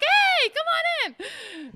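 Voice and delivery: high, drawn-out mock-eager welcome; gushing welcome. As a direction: wave the band in with sudden delight. Suddenly welcomes the band she feared.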